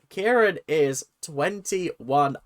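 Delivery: ordinary talk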